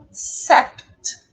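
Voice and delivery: teacher's emphatic "Sept", consonants carefully sounded; syllable demo. Shown as a demonstration. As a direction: model "Sept" firmly as one syllable. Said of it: "Olha aqui".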